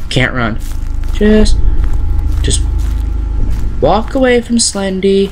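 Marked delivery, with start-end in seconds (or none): 1.1-5.3 nervous. Real, not acted. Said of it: "coaching himself to walk away".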